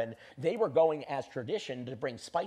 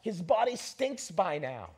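raised pitch, lightly voiced protest; objecting onlookers; 6 semitones higher than usual. Onlookers protesting to Jesus at the tomb, lightly voiced objection. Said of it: "you know what they say?".

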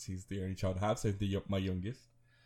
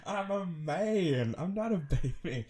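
higher, slow whiny protest; mock childish whine; 8 semitones higher, slower than usual. Son whining to his mum, drawn-out childish. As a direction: drag it out like a sulking kid.

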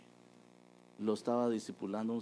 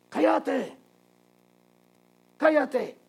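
shrieking, much higher and louder; demonic scream; 16 dB louder, much slower than usual. Retold or imitated. Possessed boy screaming at the Bible reading over the speaker, shrieking. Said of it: "aquel gritó".

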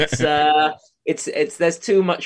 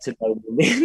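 normal talking voice

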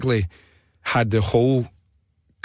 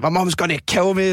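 mild mock panicked cry, higher pitch; retold screaming; 7 semitones higher than usual. Schoolkid whose bag is tied up, mock screaming. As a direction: imitate a panicking schoolkid without much volume. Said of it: "there's people pure screaming".

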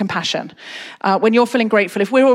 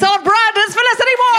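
building from raised voice to very high announcer cry; compere's rousing sign-off; 12 semitones higher than usual. Real, not acted. Host sends the comedian off to applause, building announcement. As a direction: climb steadily toward the big name.

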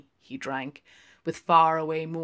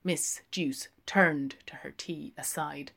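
plain reading voice

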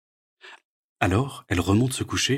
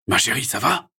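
groggy father checks on daughter; lightly voiced cavernous dad; 5 semitones higher, 4 dB louder than usual. A narrator's reading. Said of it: "d'une voix caverneuse". (voix caverneuse de papa, légère)

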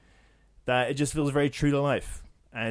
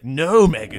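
stern shout; 3 semitones higher, 11 dB louder, slower than usual. Pretend. Mock scolding Meghan as royals.

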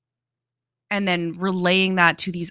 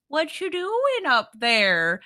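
slow, higher lazy nurse; 6 semitones higher, much slower than usual. Retold or imitated. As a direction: drag it out like a bored nurse. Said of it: "will be like".

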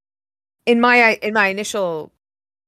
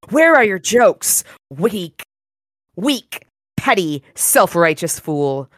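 snarling villain; slower than usual. Acted. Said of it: "Kravos snarls".

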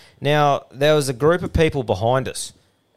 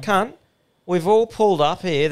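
higher-pitched mock telling-off; retold exasperation; 7 semitones higher than usual. Podcaster retells scolding his mate over the TV channel, exasperated. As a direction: plead your case to a useless mate, pitched up.

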